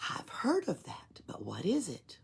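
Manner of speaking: soft, wondering whisper; curious whisper. Acted. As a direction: ask under your breath in awe. Oscar asks in whisper.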